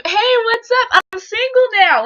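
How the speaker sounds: loud, high-pitched hammed-up greeting; mock eager announcement. Mocking the idea of randomly announcing she's single, hammed-up and loud. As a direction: blurt it like a ridiculous public announcement.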